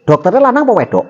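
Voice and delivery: fast nosy question; eager question. Real, not acted. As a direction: rush the teasing question. Teasing question about doctor.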